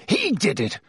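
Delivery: put-on teacher rants about the boy; shrieking accusation. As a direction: insist wildly.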